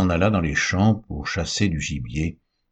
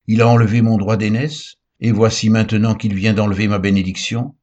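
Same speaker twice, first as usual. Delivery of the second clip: loud, bitter protest from a wronged son; bitter outcry; 4 semitones higher, 6 dB louder than usual. A narrator's reading. Esau crying out bitterly to his father, loud and wounded. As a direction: cry it out, stung and louder. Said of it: "il poussa de forts cris, pleins d'amertume".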